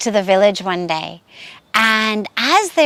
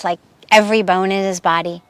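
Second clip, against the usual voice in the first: ordinary delivery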